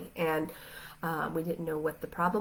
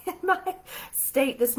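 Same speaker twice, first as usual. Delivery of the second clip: light embarrassed laugh through the words, pitch rising; laughing at herself mid-sentence; 8 semitones higher, 3 dB louder than usual. Real, not acted. To her viewers about her unshowered state, laughing.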